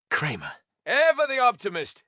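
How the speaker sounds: plain reading voice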